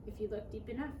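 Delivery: plain reading voice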